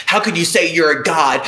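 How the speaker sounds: parroting an indignant doubter; mock scandalized listener. Streamer mimics a viewer doubting his godhood, mock outrage. Voicing a listener's accusing question.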